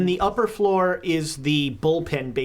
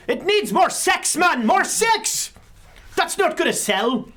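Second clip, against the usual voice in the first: yelling editor; 10 semitones higher, slower than usual. Acted. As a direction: yell it drawn out, like a blustering editor. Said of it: "like, yelling".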